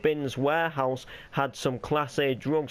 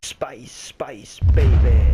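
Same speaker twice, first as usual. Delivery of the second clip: whispered; 2 semitones lower, 11 dB louder, slower than usual. Acted. Whispered ad jingle intro.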